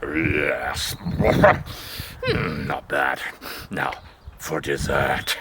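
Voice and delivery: deep satisfied wolf; a greedy wolf after his main course; low wolf voice. Acted. Wolf savouring second pig.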